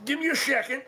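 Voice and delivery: put-on high, creaky old turtle voice warming up; very old man. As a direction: find the ancient shopkeeper voice. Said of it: "I haven't done this voice in a minute".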